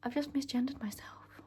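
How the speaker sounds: soft embarrassed whisper; whispered aside. Real, not acted. Vlogger catching her own slip, whispered aside. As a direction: whisper it to yourself. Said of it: "aside after slip".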